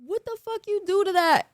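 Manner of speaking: high astonished questioning; shocked security guard. Retold or imitated. Security guard asking about the injury, high stunned surprise. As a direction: ask it stunned and amazed. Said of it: "he's like".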